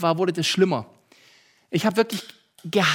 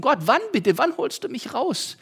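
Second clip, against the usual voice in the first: higher, pleading question; calling to God; 7 semitones higher than usual. Retold or imitated. Tired electrician calling God, mildly pleading. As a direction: plead upward in mock desperation. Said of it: "habe zu Gott gerufen".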